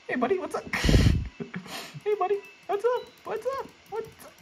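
very high, sweet mock-friendly voice; high cartoon voice luring the victim; falsetto greeter.

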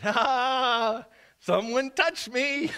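high, laughing insistence; delighted laughing. Retold or imitated. Jesus answering Peter in the crowd, laughing. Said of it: "But Jesus insisted".

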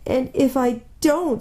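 acted whimper, dragged out; beginning to cry. A narrator's reading. Faun fearing the Witch, starting to cry. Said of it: "beginning to cry again".

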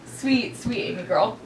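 soft, cooing praise. Pretend. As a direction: coo over her sweetly. Softly praising roommate.

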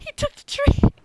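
very high, loud squeal through laughter; real laughing squeal. Real, not acted. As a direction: squeak it out while cracking up. Cracking up at the crash.

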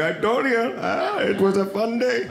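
high-pitched imitation of the driver; driver impression. Van driver reminiscing with his friend, high put-on voice. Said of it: "he's like".

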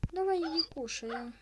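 loud high call to come. Real, not acted. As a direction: call them over to eat. Calling animal to eat.

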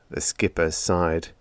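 usual speaking voice

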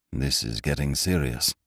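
low, soft worried mutter. A narrator's reading. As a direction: mutter it under your breath. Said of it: "muttered the doctor".

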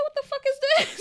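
high-pitched, indignant retold outburst; outraged disbelief. Acts out finding only marshmallows.

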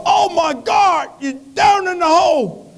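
high, shouted Baptist preacher cry. Acted. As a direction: holler in alarm like a revival preacher, drawn out. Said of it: "a Baptist preacher walked by".

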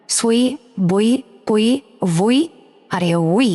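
সুই, বুই, পুই, each with a rounded glide into i; exceptional readings. Shown as a demonstration. Teacher recaps five different letters, rounded সুই and বুই. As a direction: list সুই to উই separately, lips rounded. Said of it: "একটু আলাদাভাবে উচ্চারণ করতে হবে".

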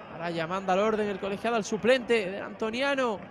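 ordinary talk, nothing marked

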